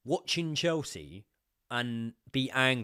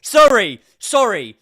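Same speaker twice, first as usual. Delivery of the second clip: very high, loud goal screams with laughter; ecstatic screaming; 19 dB louder than usual. Real, not acted. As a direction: scream with joy as he scores. Celebrating a goal.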